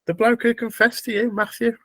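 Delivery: ordinary talk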